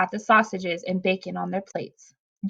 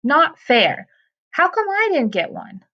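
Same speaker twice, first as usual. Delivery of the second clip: put-on high-pitched childish protest; 7 semitones higher than usual. A narrator's reading. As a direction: whine that it's not fair. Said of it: "protested Jack".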